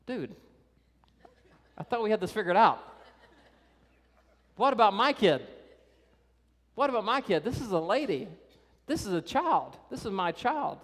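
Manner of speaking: a desperate dad calling out, acted; frantic father Jairus. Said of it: "he's like".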